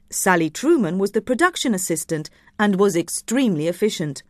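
ordinary delivery

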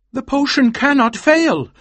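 very high, loud, vehement exclamation; emphatic insistence. A narrator's reading. Husband countering his wife's despair, loud and vehement.

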